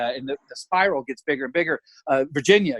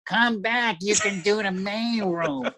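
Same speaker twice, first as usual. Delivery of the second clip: imitating a son who whines like his mother; high, slow, softer whine; Paulie; 6 semitones higher, 4 dB quieter, slower than usual. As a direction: drawl it out in a pitched-up mock whine. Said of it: "He said it just like her".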